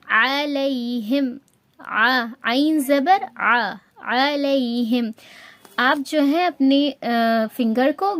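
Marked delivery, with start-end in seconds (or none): 0.1-5.1 علیہم slowly drawn out with a mid-throat عین; عین in recitation. Shown as a demonstration. Teacher demonstrating عین on another word, slow and sustained. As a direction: spell out and recite the throaty first sound of علیہم. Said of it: "پھر ایسے ہی ایک word ہے".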